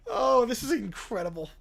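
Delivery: ordinary talk, nothing marked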